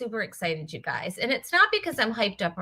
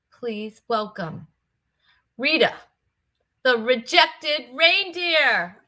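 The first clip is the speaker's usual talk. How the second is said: announcer intro; slower than usual. Acted. Introducing the reindeer guest.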